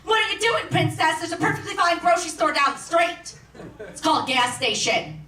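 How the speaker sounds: high-pitched mock yell from an inner blue-collar heckler. Inner blue-collar voice berating the lawyer over Whole Foods, mock yelling. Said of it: "Blue-collar voice in my head starts yelling at me".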